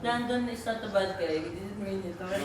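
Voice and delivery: soft soothing hush; calm him down gently. Real, not acted. Mom soothing crying Harvey, soft and gentle.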